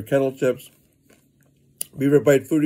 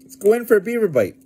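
excited; 7 semitones higher than usual. Real, not acted. Hyped before taking a bite.

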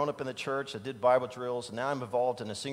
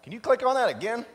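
loud, higher call across the room to the booth; 9 semitones higher, 7 dB louder than usual. Real, not acted. Preacher calling to the slides operator, loud and raised. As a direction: raise your voice so the tech at the back hears.

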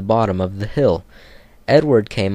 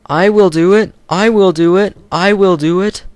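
much higher, loud determined puffing chant; puffing Edward; 10 semitones higher, 7 dB louder than usual. Acted. Edward pushing hard from behind, high determined puffing. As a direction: chug it with brave determination. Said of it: "puffed Edward".

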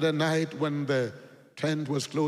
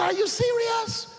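high, loud disbelief; young man stunned it costs nothing, loud; astonished shout; 6 dB louder than usual. Retold or imitated. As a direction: blurt it out amazed. Millionaire amazed at free study.

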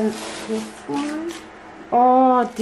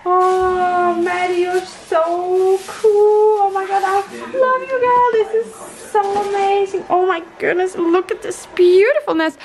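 thrilled and adoring, pitched up; gushing admiration; 5 semitones higher than usual. Real, not acted. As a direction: gush high with love for the gift. Gushing thanks over gifts.

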